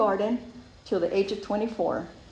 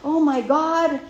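panicky; 6 semitones higher, 5 dB louder than usual. Retold or imitated. Spiraling fear of getting caught.

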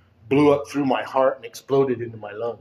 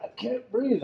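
high, strained plea for help; retold breathless panic, pitched way up; desperate plea; 12 semitones higher, 4 dB quieter, slower than usual. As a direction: act out struggling for air. Gasped plea at hospital desk.

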